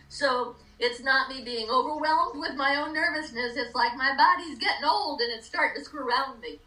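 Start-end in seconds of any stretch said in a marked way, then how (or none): none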